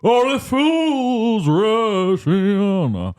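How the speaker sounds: slow crooning [name] voice; [name] impression. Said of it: "I can do it a decent [name] impression".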